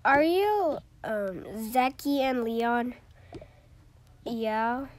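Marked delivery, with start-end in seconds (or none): 0.1-2.9 loud questioning. Pretend. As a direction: ask it loudly. Teacher checks names.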